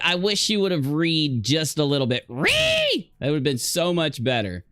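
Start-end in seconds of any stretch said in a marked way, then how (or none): 2.3-3.1 sudden high shouted word; mock yell. Viewer yelling at a video clip, high mock shout. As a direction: bark it at the screen like a frustrated viewer.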